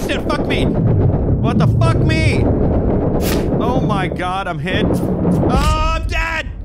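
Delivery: high, loud panicked yelling; panicked shouting. Real, not acted. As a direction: yell in panic as you get shot. Player gets hit and dies.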